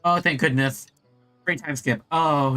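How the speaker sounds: normal talking voice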